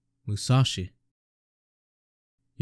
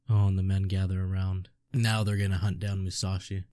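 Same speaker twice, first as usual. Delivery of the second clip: ordinary talk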